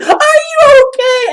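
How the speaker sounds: high, loud mock shriek; retold alarmed yell. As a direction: shriek it like a worried friend. Acting out friend's question.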